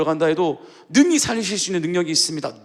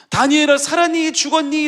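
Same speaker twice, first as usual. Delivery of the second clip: anxious asking; 9 semitones higher, 4 dB louder than usual. Shown as a demonstration. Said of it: "라고 하는 것도 아니에요".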